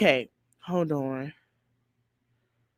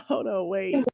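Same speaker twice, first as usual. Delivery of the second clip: ordinary delivery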